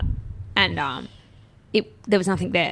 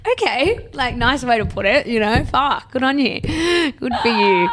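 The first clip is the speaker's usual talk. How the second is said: higher, mock-impressed good for you; drawn-out sarcastic approval; sarcastic praise; 5 semitones higher, slower than usual. Friend mocking Emily's waiter speech with sarcasm.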